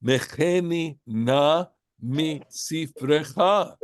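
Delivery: slow, measured Hebrew reading; lightly chanted scripture line; slow Hebrew recitation. Acted. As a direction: intone the Hebrew verse deliberately. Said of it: "because he's saying".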